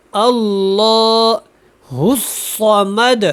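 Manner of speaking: اللہ الصمد with heavy ل and ص; slow recitation. Shown as a demonstration. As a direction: recite slowly, heavy letters. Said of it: "اس کو موٹا پڑھیں". (آہستہ موٹے حروف کے ساتھ)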